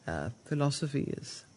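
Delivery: usual speaking voice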